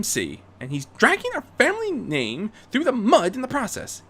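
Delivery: Rarity, indignant. Acted. Rarity denouncing Derek Powers to her father, indignant. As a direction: bristle at the rival.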